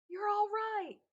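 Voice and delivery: loud, high joyful bellow. A narrator's reading. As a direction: bellow it with relief. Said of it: "Mister Mincy bellowed".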